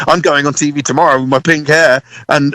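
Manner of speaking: usual speaking voice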